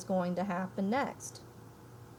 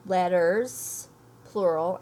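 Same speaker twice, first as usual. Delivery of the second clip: "letters, plural" punched louder; stress on letters plural; 6 dB louder, much slower than usual. Shown as a demonstration. Teacher stressing the plural to her students. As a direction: hit "letters" and "plural" hard.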